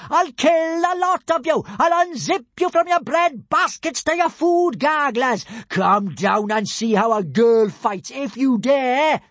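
high, furious threats; top-of-voice yell. A narrator's reading. Kamikaze threatens the laughing Romans, yelling at full voice. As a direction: rage at the crowd, stretching the final dare. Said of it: "yelled at the top of her voice".

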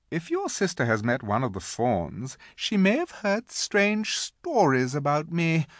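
lightly played queen, lilting and persuasive; lightly voiced queen coaxing a boy into a secret; coaxing, scheming Queen. A narrator's reading. Queen coaxes conspiratorially.

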